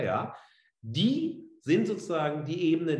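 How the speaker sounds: ordinary talk, nothing marked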